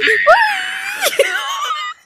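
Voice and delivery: high laughing shriek; high cackle. Real, not acted. After pushing Kenny off the edge, cackling.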